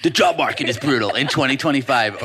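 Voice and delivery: loud, high-pitched video hook. Shown as a demonstration. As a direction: belt it out like an attention-grabbing opener. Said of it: "Do your, do your hook voice".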